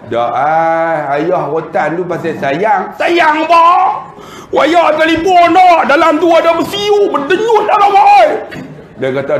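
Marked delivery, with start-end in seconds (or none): 2.9-8.3 put-on very high, loud, shouted child's complaint; shrill-pitched mock child shout, much higher and louder; child yelling in pain. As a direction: yell it like a hurt boy talking back. Child yelling back at father.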